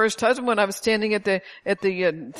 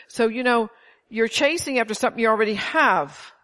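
ordinary talk, nothing marked

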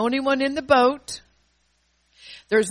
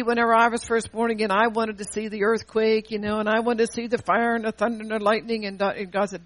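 usual speaking voice

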